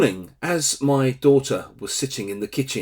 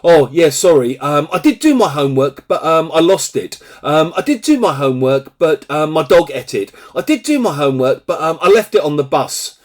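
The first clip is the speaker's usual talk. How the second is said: hurried, louder, higher-pitched imitated excuses; excuse-making student; 4 semitones higher, 6 dB louder, faster than usual. Student to teacher about missing homework, hurried excuse-making. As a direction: reel off feeble excuses at a quick pace. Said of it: "four or five times they tell you".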